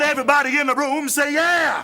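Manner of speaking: high shouted revival-preacher call; hammed-up evangelist holler; televangelist preacher. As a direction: rouse the congregation to answer. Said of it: "I need some audience participation".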